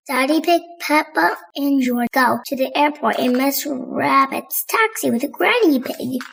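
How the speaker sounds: ordinary delivery, nothing marked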